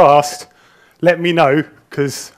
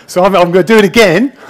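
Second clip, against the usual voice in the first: laughing through it, a bit louder; 3 semitones higher, 9 dB louder, faster than usual. Real, not acted. Speaker laughing to the audience about redoing the presentation, laughing.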